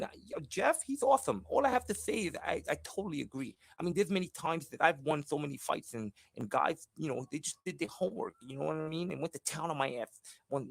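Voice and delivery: high Tyson-style voice. Acted. Boxer agreeing with the host, high and excited. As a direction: gush in a high squeaky boxer voice. Boxer persona answering interviewer.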